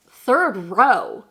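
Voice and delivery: loud, swooping disbelief; mock-shocked questioning exclamation; incredulous mom. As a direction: echo the news back in astonishment. Mom reacts to seat news.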